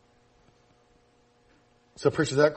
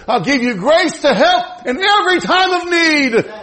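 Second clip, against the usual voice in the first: loud, high, pleading prayer from the pulpit; full-force sermon climax; impassioned preaching; 12 semitones higher, 9 dB louder than usual. Acted. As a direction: cry out to God in urgent bursts. Preacher acting out urgent prayer.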